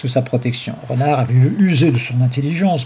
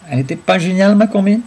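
high, loud, swaggering question; boastful; 7 semitones higher, 4 dB louder than usual. A narrator's reading. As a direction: show off your clever scheme, pitched up. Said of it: "Plastron à Renard".

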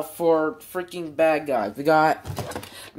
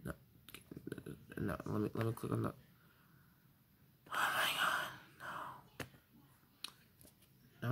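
much softer, barely voiced; dismayed whisper; 7 semitones lower, 18 dB quieter than usual. Real, not acted. Teen sees follower count, dismayed whisper. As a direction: murmur it in quiet disbelief.

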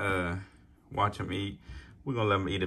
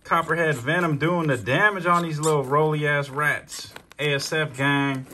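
hyped; 6 semitones higher, 5 dB louder than usual. Real, not acted. Hyping the venom's damage.

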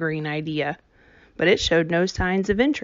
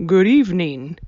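louder greeting from the gate; calling out; 5 dB louder than usual. A narrator's reading. Stranger calling a greeting to Winnie at the gate. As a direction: call a friendly greeting over the fence. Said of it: "he called out".

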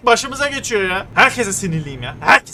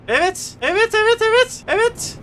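mock high voice; 14 semitones higher than usual.

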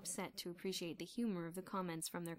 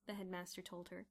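plain reading voice

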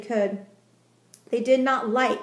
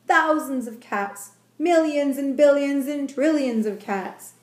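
usual speaking voice

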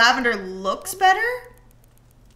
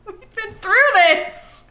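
slow, high, laughing; laughing exasperation; 9 semitones higher, 5 dB louder, slower than usual. Real, not acted. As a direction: say it mid-laugh, fed up.

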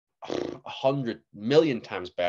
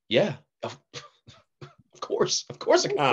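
overeager bluff; 9 semitones higher, 4 dB louder than usual. Retold or imitated. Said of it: "And I'm like".

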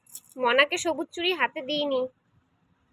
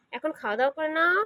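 normal talking voice

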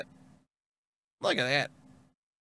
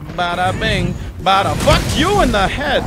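loud, punchy victory shout; loud exultant shout; triumphant yell; 6 dB louder than usual. Real, not acted. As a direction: bellow it as the big slam lands. Landing a big hit.

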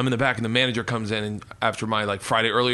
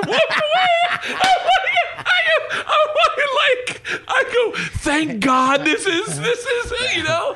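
squealing very high laugh through the words; words swallowed by shrieking laughter; helpless laughter; 4 dB louder, much slower than usual. Real, not acted. Cracking up retelling the cook.

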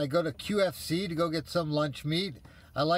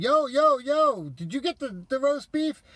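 calling out loud and high across the deli; friendly yell; 8 semitones higher, 5 dB louder than usual. Retold or imitated. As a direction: shout over the crowd, not aggressive. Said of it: "I yell out".

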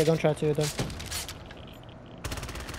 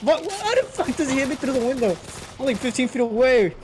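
high disbelieving yelp; shocked outcry; 7 semitones higher than usual. Real, not acted. Shocked at being hit.

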